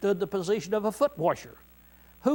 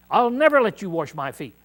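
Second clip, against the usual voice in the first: loud, emphatic protest; louder, insistent refusal to the Lord; adamant refusal; 3 semitones higher, 8 dB louder than usual. Acted. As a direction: Peter flatly refusing, stress on never. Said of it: "Peter was so adamant".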